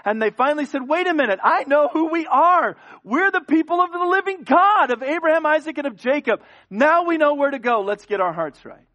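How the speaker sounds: preaching at full force. Acted. Preacher voicing the Israelites' cry, at full force.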